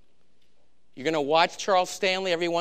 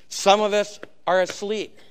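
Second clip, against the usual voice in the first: louder and higher, driving it home; emphatic repeat; 4 semitones higher, 3 dB louder than usual. Shown as a demonstration. Preacher rousing the congregation, louder emphatic repeat. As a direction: raise the voice and press the point. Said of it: "repeated louder".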